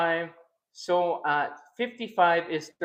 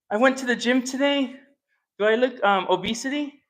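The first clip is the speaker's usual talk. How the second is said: higher, sing-song fishing for compliments; playful preening; 7 semitones higher, 4 dB louder than usual. Acted. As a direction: show off the apron for the chat. Answers chat, preening about apron and weight.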